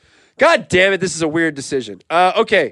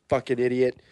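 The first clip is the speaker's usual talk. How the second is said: ordinary delivery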